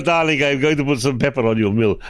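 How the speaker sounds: Polish Italian waiter accent, lightly hammed-up; light Polish Italian accent, teasing the restaurant staff; mock Polish Italian accent. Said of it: "Polish Italian".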